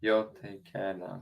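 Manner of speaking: slow, soft Southern drawl; Southern accent. Acted. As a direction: draw it out like an American Southerner. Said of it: "You're more Southern".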